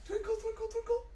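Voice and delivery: very high twinkling squeak; twinkly high voice. Acted. Showing the little star to the kids, very high.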